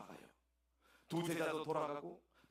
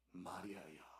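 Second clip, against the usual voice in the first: much lower, soft and gentle; tender call; 8 semitones lower, 10 dB quieter, slower than usual. Acted. Jesus gently calling Mary by name, low and soft.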